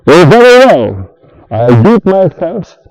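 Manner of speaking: loud, fed-up little hen; exasperated hen. Acted. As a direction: huff it out like a put-upon hen. Hen voiced in story.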